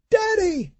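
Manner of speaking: put-on high, frightened child's cry; terrified girl crying out. A narrator's reading. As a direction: scream for her father in fear. Terrified girl calling her father.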